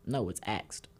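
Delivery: "axed" not ask. Shown as a demonstration. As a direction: say "axed" with a hard X sound. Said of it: "that was hard X".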